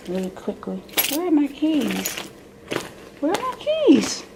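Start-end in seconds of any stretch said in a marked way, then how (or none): 1.1-2.1 higher, softer self-directed question; puzzled searching. Real, not acted. Muttering to herself while hunting for keys, puzzled. 3.2-4.2 very high sing-song question; high-pitched searching. Real, not acted. Calling out for her missing keys, high-pitched.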